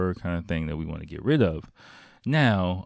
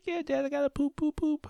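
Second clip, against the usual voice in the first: put-on squeaky high little-kid voice; young son. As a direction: imitate a small boy desperate for the toilet. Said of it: "He's like, he's like".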